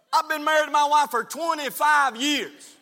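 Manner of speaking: fiery pulpit shout with stretched-out key words; full-force preaching. Real, not acted. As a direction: drive each phrase home to the congregation.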